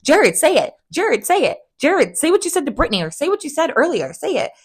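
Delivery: high, pushy mock demand; imitating Lisa. Imitating Lisa badgering Jared, high and insistent. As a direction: badger him to say it.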